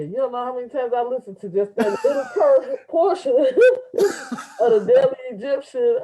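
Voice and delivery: gushing through laughter; laughing. Real, not acted. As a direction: laugh while praising the video. Host laughing while praising.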